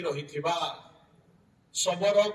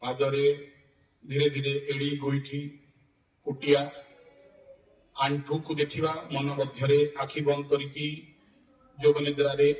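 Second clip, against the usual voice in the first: low-key, even meditation voice with long pauses; guided yoga nidra; 4 semitones lower than usual. Real, not acted. Yoga teacher guiding students to relax from the feet up, guided meditation. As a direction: guide them through the body, unhurried.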